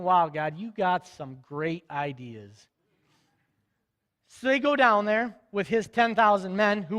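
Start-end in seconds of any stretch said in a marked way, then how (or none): 0.0-2.7 low, slow sarcastic aside; mock God's plan with dry sarcasm; sarcastic Gideon. Gideon doubting God's orders, slow and sarcastic. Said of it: "Gideon's gotta be thinking".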